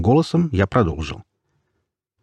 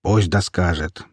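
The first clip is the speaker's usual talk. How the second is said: ordinary delivery, nothing marked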